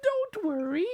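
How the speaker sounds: high, friendly squeak; peeping mouse. A narrator's reading. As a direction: reassure him as a tiny mouse. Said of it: "Mouse peeped".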